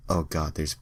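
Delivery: plain reading voice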